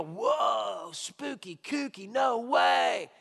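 hammed-up gasp of amazement; mock awe at a spooky miracle; mock spooked reaction. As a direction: play the gawking skeptic, all wide eyes. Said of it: "so we can go".

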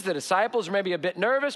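ordinary talk

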